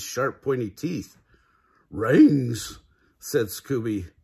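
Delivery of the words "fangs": loud talking-dog exclamation; Scooby's dog voice. A narrator's reading. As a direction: blurt it out loud in dog speech.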